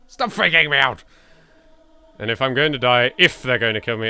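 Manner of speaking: normal talking voice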